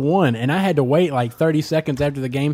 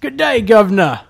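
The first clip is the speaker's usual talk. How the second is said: higher, louder hammed-up Cockney-British; over-the-top British accent, higher and louder; mock British; 7 semitones higher, 8 dB louder than usual. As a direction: ham up a stage Brit greeting. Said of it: "Is that kind of British?".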